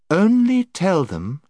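high, slow, sweet lady; honeyed voice. Acted. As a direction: say it sweetly, drawing them in. Lady's voice.

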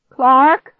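high, loud call, rising at the end; shout. A narrator's reading. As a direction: shout for a missing brother. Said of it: "I shouted".

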